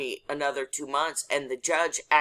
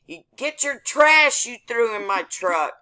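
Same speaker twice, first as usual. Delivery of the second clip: yelled demand, higher pitched, punching trash; retold yell; 7 semitones higher, 6 dB louder than usual. Driver shouting back at the cop who ticketed her, retold yell. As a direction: yell it as if re-enacting an angry shout. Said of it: "I'm yelling at the cop".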